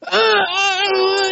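slow, high imitated crying shout; frustrated child yelling. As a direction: mimic a kid wailing at a knotted shoe. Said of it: "he's just yelling".

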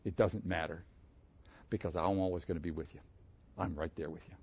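soft, low reassurance; lightly voiced gentle comfort; reassuring God. Preacher voicing God reassuring him, soft and low. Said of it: "The Lord reassured me".

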